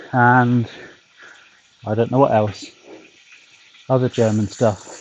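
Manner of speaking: ordinary talk, nothing marked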